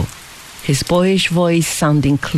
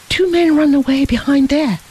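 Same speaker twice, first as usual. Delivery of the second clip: mild narrated cry of alarm; 9 semitones higher than usual. A narrator's reading. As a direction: point and warn in alarm. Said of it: "cried he".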